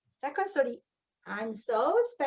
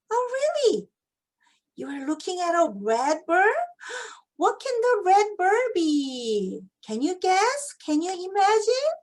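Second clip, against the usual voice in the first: mock wonder; 5 semitones higher, 4 dB louder than usual. Shown as a demonstration. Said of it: "teacher acting wonder for kids".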